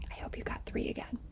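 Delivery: hushed aside; whisper. Real, not acted. Teacher whispering hope to students. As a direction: whisper it hopefully. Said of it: "teacher whispers aside".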